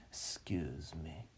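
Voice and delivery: a real quick whisper after a cough; whispered excuse me. Real, not acted. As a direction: drop to a breath for the apology. Whisper after clearing throat.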